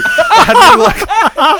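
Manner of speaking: high, loud laughing squeal. Real, not acted. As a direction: voice jumps way up, laughing through the words. Laughing while telling the story.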